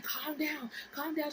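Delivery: lightly voiced mother urging calm; insistent motherly imitation; mom calming down. Mom voiced soothing her.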